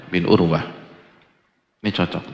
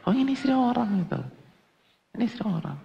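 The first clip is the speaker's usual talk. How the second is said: much higher, gushing mock delight; 13 semitones higher, 3 dB quieter, faster than usual. Preacher mocking husbands who gush over other wives. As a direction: ham up the eager husband.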